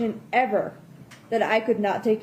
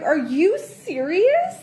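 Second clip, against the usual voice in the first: disbelieving; 6 semitones higher, slower than usual. Retold or imitated. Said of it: "I was like".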